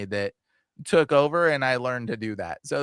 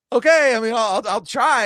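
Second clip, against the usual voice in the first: nervous agreeing; 9 semitones higher, 6 dB louder than usual. Retold or imitated. Retold nervous reply to request.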